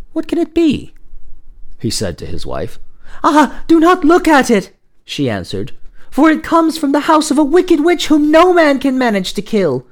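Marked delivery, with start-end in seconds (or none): 0.1-0.9 high-pitched puzzled question; wondering husband. Acted. Husband wonders at strange light. 3.2-4.7 high, loud cry of alarm; alarmed warning. Acted. Wife warns her husband. 6.1-9.9 high, loud, urgent wife; alarmed warning. Acted. Wife warns of wicked witch.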